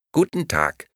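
high, squawking doll greeting; a tinny talking doll chirping; squawky doll voice. A narrator's reading. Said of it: "quäkte die Puppe".

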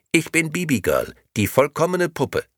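sweet, empty doll voice; talking doll. Acted. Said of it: "sagte die Puppe".

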